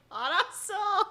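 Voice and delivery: high, laughing mock reply; laughing reaction. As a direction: squeak it out through laughter. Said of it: "And you're just like".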